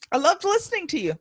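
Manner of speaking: lightly voiced high gushing fan; a hint of a starry-eyed client. Host mock-gushes as her clients, high and light. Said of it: "that's why they're like".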